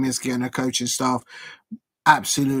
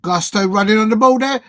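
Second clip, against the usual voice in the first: louder, higher play-by-play call; excited commentary; 6 semitones higher, 8 dB louder than usual. Real, not acted. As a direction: call the run with a lift. Live match commentary burst.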